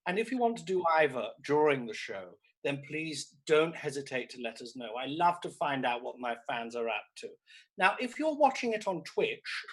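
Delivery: usual speaking voice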